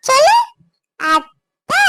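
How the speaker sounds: அத்தை sung up with a rising coax; coaxing sing-song. Shown as a demonstration. Aunt coaxes baby to say அத்தை, rising sing-song. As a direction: lilt அத்தை upward for the baby to copy. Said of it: "மொத நீ அத்தைன்னா கூப்பிடணும்".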